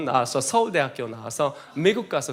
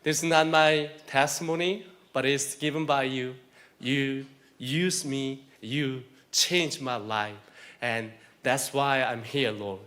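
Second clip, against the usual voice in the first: slow, soft earnest prayer; 2 semitones lower, 6 dB quieter, much slower than usual. Acted. Preacher praying softly to the Lord, slow and hushed. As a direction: pray it quietly and slowly, addressing God. Closing prayer before the sermon.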